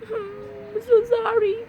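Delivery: high, slow, half laughing, half crying; laughing through an apology. Real, not acted. Mom apologizing to the broken eggs, laughing and crying. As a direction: giggle out the sorry, a little ashamed.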